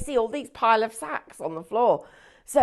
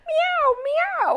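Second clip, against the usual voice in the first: high mock cat meowing; cat meows; 3 dB louder than usual. Scottish man in a sack, high mock meowing. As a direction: meow like a kitten, pitched up. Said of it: "The Scottish man goes".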